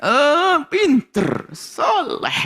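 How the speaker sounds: put-on praising a child. Shown as a demonstration. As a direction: coo praise at your child. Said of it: "gitu loh".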